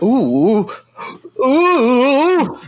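high hooting "ooh"; mock wailing, swooping up high; imitated wail. Co-host imitating the man as he blows up, high mock wail. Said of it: "He's like".

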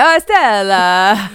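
loud, drawn-out mock Italian accent; bad Italian accent. Host voices the letter to Stella, in a bad Italian accent. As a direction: wail it in a hammed-up Italian accent. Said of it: "This letter is written in an Italian accent".

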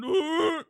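loud, high mock creature call; Bigfoot call. Podcaster imitating documentary makers' Bigfoot calls, loud creature howl. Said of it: "they do their calls and they're going".

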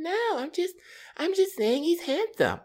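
mock female falsetto; very high, protesting as the girlfriend; defensive girlfriend. Acting girlfriend defending herself.

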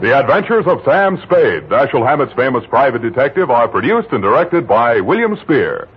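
ordinary delivery

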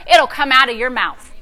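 usual speaking voice

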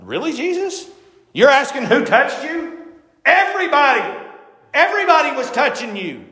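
mock the disciples' incredulity; exasperated disbelief, acted out; incredulous disciples. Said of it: "the disciples are like".

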